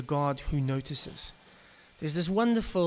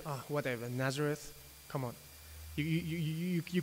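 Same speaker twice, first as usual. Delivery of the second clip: cynical dismissal; 6 dB quieter than usual. Retold or imitated. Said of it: "So he is deeply cynical".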